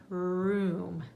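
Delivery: "room" drawn out as one syllable; sounded out. Shown as a demonstration. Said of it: "Now sound out your syllable".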